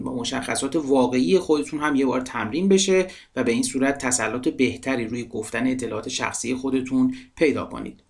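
normal talking voice

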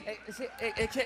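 soft, stammering mumble; mock confused winner, trailing stammer; lost award winner. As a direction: dither quietly as if unsure which way to go. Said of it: "qui ne savent pas dans quelle direction partir".

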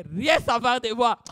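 fervent sermon close; preaching rising. Acted. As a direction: build to the point. Preaching outburst.

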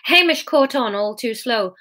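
usual speaking voice

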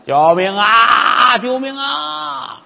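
slow, stretched call for help, fading; stretched 救命啊 that trails away; drawn-out cry for help. Acted. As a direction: call 救命 long and slow into the distance. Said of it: "俩人一块儿扯破了嗓子".

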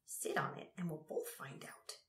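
hushed, unvoiced whisper. A narrator's reading. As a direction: whisper it softly, as a secret. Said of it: "whispered Ebbet".

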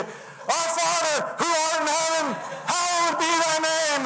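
high, drawn-out recital of a prayer; slow, high-pitched mock praying on the run; buddy praying aloud. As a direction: imitate a terrified buddy calling out his prayer. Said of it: "in his thick New England accent".

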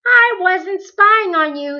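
usual speaking voice

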